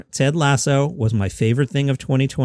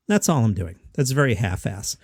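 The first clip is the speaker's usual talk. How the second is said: ordinary talk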